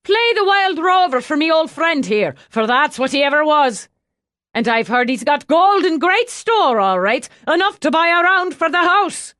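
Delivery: loud, high call across a noisy pub; hearty calling out. A narrator's reading. Brian calls to the band for his friend, loud and high. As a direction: keep shouting over the music.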